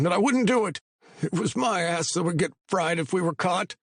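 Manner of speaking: high, anxious pleading voice; a scared informant talking fast; nervous young man. A narrator's reading. Patty frightened explanation.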